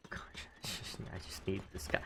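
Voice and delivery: very soft, near-whispered self-talk; muttering to self. Real, not acted. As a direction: whisper it absently to yourself. Muttering to self while building.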